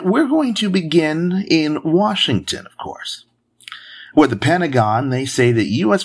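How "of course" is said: breathy throwaway whisper; whispered aside. Acted. Host muttering filler between news items, whispered. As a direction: toss it off under your breath.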